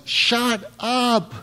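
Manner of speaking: high-pitched mock yell for silence; drawn-out, hammed-up holler; imitating a yell. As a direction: yell it like you're hushing an angry crowd. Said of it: "yelling at the top of his lungs".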